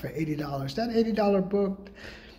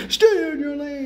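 higher, louder road-rage shout; angry driver yelling; yelled at drivers; 6 semitones higher, 5 dB louder than usual. Retold or imitated. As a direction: bark it at the car ahead.

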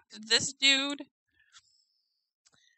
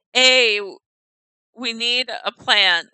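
reading Beaufort's line; 10 dB louder, slower than usual. Retold or imitated. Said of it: "Beaufort says".

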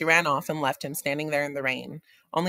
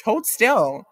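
playful order; 7 semitones higher, 8 dB louder than usual. A narrator's reading. Girl playful command.

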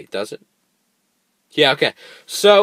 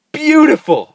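high, loud happy exclaim; relieved delight; 10 dB louder than usual. Real, not acted. Player catching the sunrise, high delighted cry. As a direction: burst with relief.

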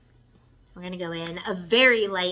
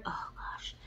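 softer, whispered awe; 14 dB quieter than usual. Real, not acted. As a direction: whisper it in admiration. Admiring purple shade.

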